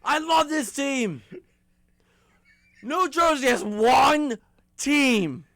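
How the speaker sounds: high, slow, loud lament; exasperated rant. Real, not acted. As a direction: drag it out in despair. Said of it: "time for me to complain".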